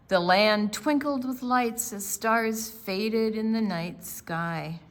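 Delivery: story narration. A narrator's reading. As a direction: read the picture book to the class. Read-aloud story narration.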